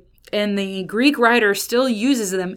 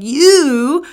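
"You" shouted out high and long; heavy emphasis on You; 6 semitones higher, 8 dB louder than usual. Shown as a demonstration. Preacher echoes John addressing his readers, heavy stress on You. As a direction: boom out "You", well above normal talk. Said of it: "the emphasis that I think".

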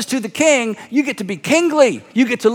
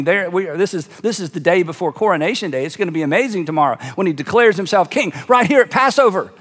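usual speaking voice

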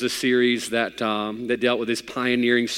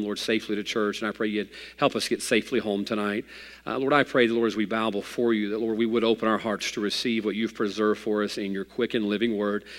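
prayer; 2 semitones lower, 5 dB quieter than usual. Acted. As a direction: pray quietly to the Lord before the sermon. Pastor leads opening prayer.

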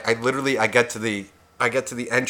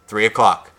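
ordinary delivery

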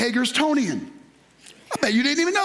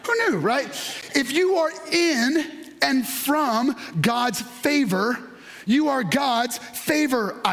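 usual speaking voice

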